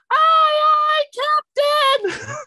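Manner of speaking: put-on very high, loud little-kid shout; piercing, drawn-out tiny voice; tiny child voice. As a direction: squeal it out like an eager four-year-old. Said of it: "a tiny little voice just go".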